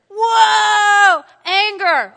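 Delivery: long, high, very loud "whoa"; mock alarmed shout. Preacher reacting as he announces anger, big shout. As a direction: bellow it out, stretched.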